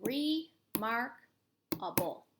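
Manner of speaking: remarkable very slow, split into parts; pounded-out syllables. Shown as a demonstration. Teacher pounding out remarkable for spellers, syllable by syllable. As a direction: stretch re, mark, able with gaps between. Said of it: "let's pound this one out".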